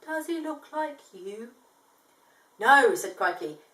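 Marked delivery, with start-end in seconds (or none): 0.0-1.5 slow, soft koala; drowsy and soft; sleepy slow question. Acted. 2.6-2.9 loud, sharp no; firm no. Acted. Emphatic denial.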